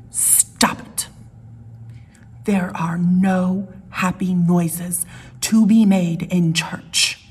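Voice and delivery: slow, hissed whisper with no voice; retold hushing whisper. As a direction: shush him like a stern churchgoer in the pew. Woman in pew hushing him.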